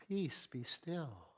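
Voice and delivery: hushed, slow, gentle "Peace, be still."; the soft churchy way it's usually read. Shown as a demonstration. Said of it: "I don't think Jesus said it like that at all".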